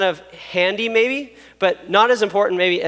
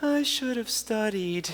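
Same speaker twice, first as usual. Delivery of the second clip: much softer, slow, deflated regret; regretful mutter; 3 semitones higher, 10 dB quieter, slower than usual. Retold or imitated. Student at the test regretting, much softer and slow. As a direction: sigh it out under your breath. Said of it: "you're like".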